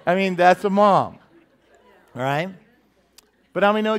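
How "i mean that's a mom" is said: ordinary talk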